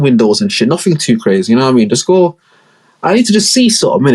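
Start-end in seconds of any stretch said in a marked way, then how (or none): 1.9-4.1 higher mocking order voice; mock high boss voice. Boss sending his boys out, high mock voice. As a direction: bark orders in a squeaky mock voice.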